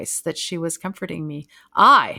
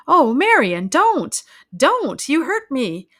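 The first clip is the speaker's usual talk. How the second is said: much louder, higher pained cries; crying out in pain; 7 semitones higher, 10 dB louder, slower than usual. A narrator's reading. Laura crying out as her sister grips her. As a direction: cry out to be let go. Sister gripped too hard.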